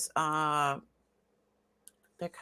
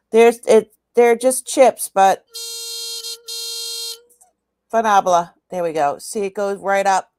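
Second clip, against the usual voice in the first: louder over a buzzing tester; 3 semitones higher, 8 dB louder than usual. Real, not acted. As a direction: push your voice over machine buzz. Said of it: "The buzzing's making them excited".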